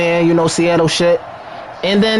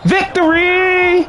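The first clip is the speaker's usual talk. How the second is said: high, drawn-out victory shout; victory cry; 11 semitones higher, much slower than usual. Real, not acted. Gamer cheering his win, long and high. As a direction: stretch the word out in triumph.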